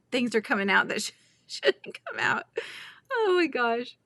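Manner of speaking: words breaking up into laughter; tongue-tied giggling. Real, not acted. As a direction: giggle helplessly through the sentence. Tongue-tied, cracking up at herself.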